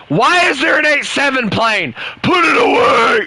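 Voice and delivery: high, loud mock yell; mock shouting algorithm. The algorithm yelling at him, mock furious shout. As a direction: shout it like an angry boss scolding.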